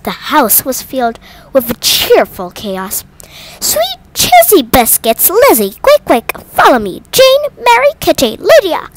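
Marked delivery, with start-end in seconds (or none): 3.6-8.9 high, loud, excited mother calling out; a flustered mother crying out for her daughters, high and loud. A narrator's reading. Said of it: "Missus Bennet cried".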